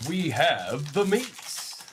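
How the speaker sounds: slow mock commercial announcer; Arby's ad slogan. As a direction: lightly do the Arby's ad slogan, drawn out.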